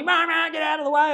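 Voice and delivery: high mock rant of an impatient driver; lightly hammed-up road rage; mock angry driver. Impatient driver behind him.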